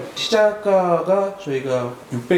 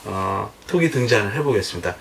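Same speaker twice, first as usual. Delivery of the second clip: usual speaking voice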